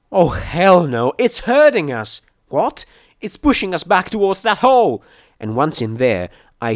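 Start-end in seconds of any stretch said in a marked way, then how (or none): 0.1-2.2 loud, high cry of dismay; alarmed shout. Acted. Realising the monster is cornering them, alarmed shout. 2.5-2.8 high, rising "What?"; startled question. Acted. Not understanding the alarm mid-attack, startled question. 3.2-5.0 loud, high-pitched alarm; panicked shout. Acted. Being herded back to the cave, panicked shout.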